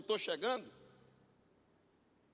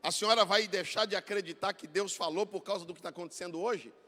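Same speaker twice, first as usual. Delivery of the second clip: loud, high preacher's exhortation; preaching at full force; 4 semitones higher, 14 dB louder than usual. Acted. Preacher urging the church to choose faith, loud full-force preaching. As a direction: drive the congregation to their feet.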